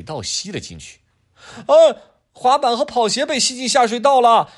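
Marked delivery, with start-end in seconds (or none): 1.7-4.5 put-on very high, loud child's cry; a little boy watching his toys go; wailing kid. Adam crying out as the drain swallows things, high wail.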